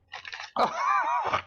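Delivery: breathy squeaky laugh rising; high-pitched giggling fit; high giggle. Real, not acted.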